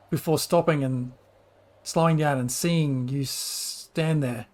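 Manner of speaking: ordinary delivery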